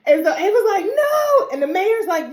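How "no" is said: squealed high, loud no; hammed-up high no; high shout no. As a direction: wail it in protest. Ava protesting loudly.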